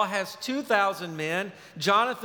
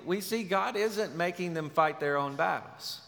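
ordinary talk

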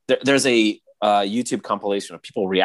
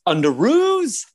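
high, drawn-out movie yell; yelled impression; 14 semitones higher, 4 dB louder, slower than usual. Hero giving Spider-Man the signal, imitated high yell. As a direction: yell it as a signal, stretching the last word. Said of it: "He yells".

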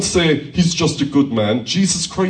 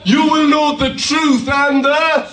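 high, louder prompt to the crowd; rallying call; 11 semitones higher, 4 dB louder than usual. Real, not acted. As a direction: rouse the congregation to answer with more enthusiasm. Repeats call for more enthusiasm.

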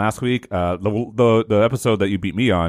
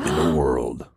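movie trailer voice; 7 semitones lower, slower than usual. Retold or imitated. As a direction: echo the epic trailer narrator, dropped low. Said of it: "A movie trailer that says".

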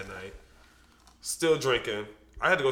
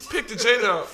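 put-on quoted girlfriend; 10 semitones higher, 8 dB louder, much faster than usual. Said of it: "She was like".

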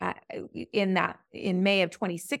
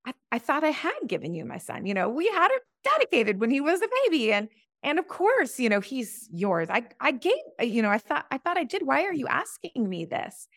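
higher, bewildered pleading with God; retold anguished prayer; 6 semitones higher, 3 dB louder than usual. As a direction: plead like a hurt, confused parent. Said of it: "And I was like".

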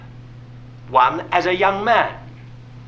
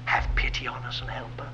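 whispered desperate plea from the father; the father begging Jesus, whispered; hushed plea; 7 semitones lower, 10 dB quieter than usual. Retold or imitated. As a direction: beg in a hush. Father's plea retold softly.

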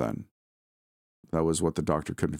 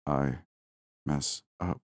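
weak croak; 3 semitones lower, 4 dB quieter, much slower than usual. A narrator's reading. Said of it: "croak some short sounds".

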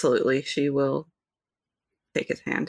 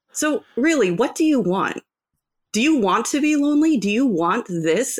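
impassioned challenge; 6 semitones higher, 4 dB louder than usual. Acted. Player confronting the lonely dwarf, impassioned challenge. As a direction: press each question, higher and louder at first.

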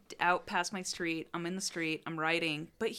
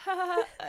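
forced nervous laugh; 12 semitones higher, 4 dB louder than usual. Retold or imitated. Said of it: "dead eyes and was like".

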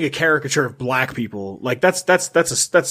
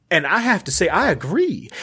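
higher-pitched, self-important fancy lady; highfalutin; 4 semitones higher than usual. Acted. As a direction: sound very full of yourself. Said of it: "very highfalutin".

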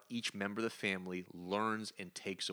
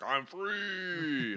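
high, drawn-out triumphant cry; hammed-up cosmic villain freed; Galactus breaking free; 7 semitones higher than usual. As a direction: cry out in release, stretching the last word. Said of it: "Galactus rises up out of the ground".

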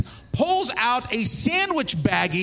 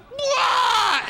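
long high mock wail; drawn-out high scream; pained cry; 11 semitones higher than usual. Labor cry over the doctor.

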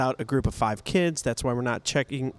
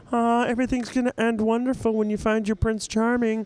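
high, strained frog puppet voice; sing-song Disney optimism as a frog puppet; Kermit the Frog voice; 9 semitones higher than usual. Retold or imitated. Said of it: "Kermit the Frog voice".

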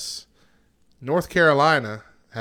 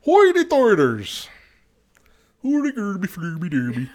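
mock High Tider gibberish; 6 semitones higher, 5 dB louder than usual. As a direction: ham up the Swedish Chef gibberish. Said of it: "Swedish Chef, you mean?".

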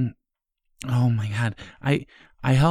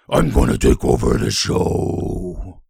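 deep, slow, loud possessed voice; demon voice; 12 semitones lower, 5 dB louder, slower than usual. Acted. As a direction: let the demon take over, slowly. Possessed voice takes over.

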